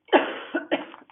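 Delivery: rough repeated cough. Real, not acted. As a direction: cough it out hard. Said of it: "I'm so sorry for coughing".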